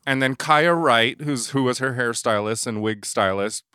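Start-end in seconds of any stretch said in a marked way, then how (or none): none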